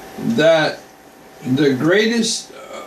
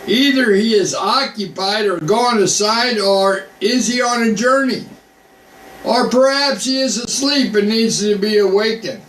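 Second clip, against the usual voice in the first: mocking; 6 semitones higher than usual. A narrator's reading. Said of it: "This is Elijah mocking these priests".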